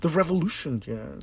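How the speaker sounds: ordinary talk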